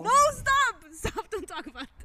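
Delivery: high, loud laughing protest; real embarrassed outburst; flustered protest. Real, not acted. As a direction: cut your friend off, squealing with embarrassed laughter. Teased about a dating-app match.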